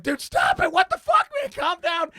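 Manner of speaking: high-pitched, loud retold shouting; pleading shout. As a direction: shout as if pulling someone off a fight.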